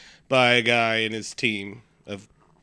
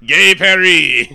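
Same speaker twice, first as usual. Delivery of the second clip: loud, high, drawn-out cartoon French; mock French accent; 5 semitones higher, 12 dB louder, much slower than usual. Mocking what he heard of the accent, exaggerated French. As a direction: belt it out in hammed-up French. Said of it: "that's all I heard".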